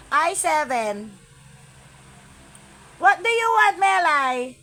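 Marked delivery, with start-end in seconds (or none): 3.0-4.6 loud call across to Melay. Real, not acted. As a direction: call out to her over the noise. Calls out to a viewer.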